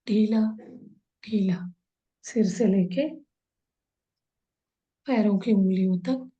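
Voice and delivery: unhurried body-scan relaxation, long pauses between phrases; guided meditation. Real, not acted. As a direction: guide them into calm, drawing out the soothing words. (ठहर-ठहर कर, शांत भाव से निर्देशित ध्यान कराते हुए)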